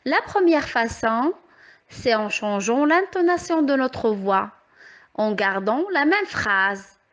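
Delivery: ordinary delivery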